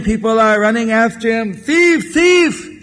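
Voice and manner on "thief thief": high, raised calling out; pursuers' cry. Acted. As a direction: call after someone on the run, pitched high. Pursuers call after running thief.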